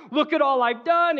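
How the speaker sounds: high, loud, eager showing-off; excited. Acted. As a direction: burst out proudly, pitch up. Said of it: "and you're, like, excited".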